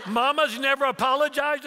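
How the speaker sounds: high, loud mock disbelief; incredulous son. Son questions dad about Mama, high and incredulous. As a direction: imitate an astonished question.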